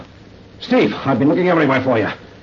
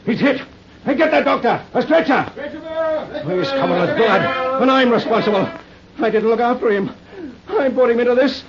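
panicked; 11 semitones higher than usual. Acted. Officer sees friend shot.

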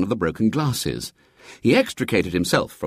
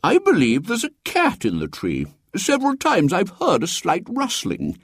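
high, stammering pompous cleric; fussy bishop; 11 semitones higher than usual. A narrator's reading. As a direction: bluster about your clever precaution. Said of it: "said the bishop".

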